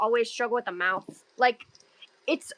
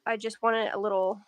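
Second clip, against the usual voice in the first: ordinary talk, nothing marked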